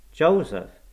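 high, loud, peevish call; peevish cry. A narrator's reading. Boy calling his servant from the inner room, peevish cry. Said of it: "cried a peevish voice".

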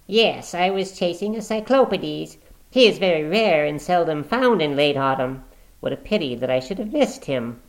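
unconcerned. Acted. Stapleton answering his sister, unconcerned. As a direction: talk of it unconcerned. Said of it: "He spoke unconcernedly".

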